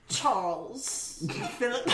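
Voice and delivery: put-on amused royal British accent; posh British accent. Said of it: "only one British accent that actually sounds nice".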